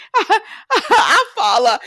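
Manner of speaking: high, slow line broken by laughter; laughing while quoting. Retold or imitated. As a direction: quote him while cracking up. Voicing Johan through laughter.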